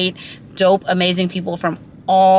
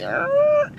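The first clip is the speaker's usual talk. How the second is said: exasperated ugh. Retold or imitated. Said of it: "I was like".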